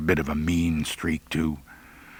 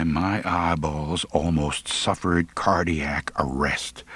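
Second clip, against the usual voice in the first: ordinary delivery, nothing marked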